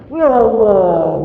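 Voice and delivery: drawn-out exclamation; loud ya allah. Real, not acted. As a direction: cry out to God. Exclaiming in sermon.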